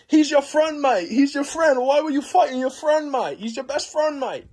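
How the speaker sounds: high, hammed-up Australian pleading; mock Australian teacher reproaching a pupil; Australian gym teacher. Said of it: "the gym teacher was saying".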